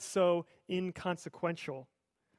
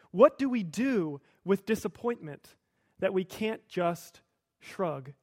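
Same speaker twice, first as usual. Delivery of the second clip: ordinary talk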